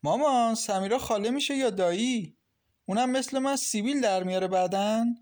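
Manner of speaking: put-on high-pitched, curious child's questioning. A narrator's reading. Little Milad asks his mother about Samira, curious and high. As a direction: ask it curiously, voice lifted high. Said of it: "کنجکاوانه پرسید".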